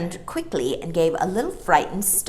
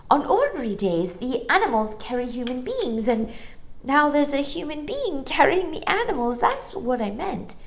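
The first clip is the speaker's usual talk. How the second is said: put-on girl voice; 9 semitones higher than usual. A narrator's reading. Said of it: "explained Jane".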